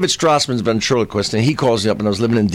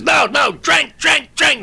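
high-pitched urging chant; insistent mock shout; slower than usual. Waitress pressing the comic to drink, insistent mock shout. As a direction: egg him on, repeating it high.

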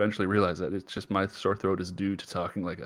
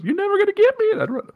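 much higher, loud, taunting squeal; crazy high-pitched voice; 8 dB louder, faster than usual. Retold or imitated. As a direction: cackle it as you flee. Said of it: "throw a dynamite at people's feet and goes".

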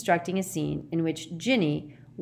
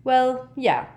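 reluctant, higher-pitched admission; sheepish start, louder then easing off; apologetic; 7 semitones higher, 5 dB louder than usual. A narrator's reading. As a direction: own up apologetically. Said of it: "said Ron apologetically".

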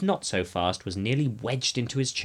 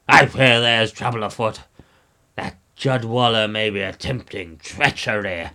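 put-on royal voice, loud start then slowing; king's voice; 5 dB louder than usual. A narrator's reading. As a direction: pronounce it gravely as a king, slowing down. King declares his fear of treachery.

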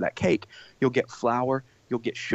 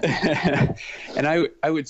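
laughing; 3 semitones higher, 3 dB louder, much slower than usual. Real, not acted. Laughing after tearful moment.

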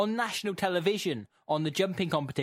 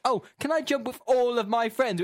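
put-on high, louder pleading child voice; Peppa; 8 semitones higher, 4 dB louder than usual. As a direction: beg like a little cartoon piglet. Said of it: "Peppa then says".

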